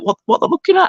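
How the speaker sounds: mock bewildered squeak; baffled. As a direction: pitch it way up, lost and puzzled. Retold bafflement while looking around.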